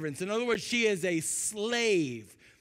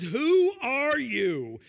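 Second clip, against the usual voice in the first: slow, louder, drawn-out question; a hint of startled Boaz in the dark; 4 semitones higher, 5 dB louder, much slower than usual. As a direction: stretch it out, a little alarmed. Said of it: "This is hilarious".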